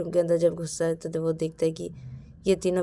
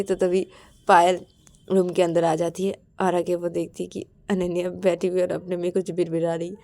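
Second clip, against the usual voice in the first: ordinary delivery, nothing marked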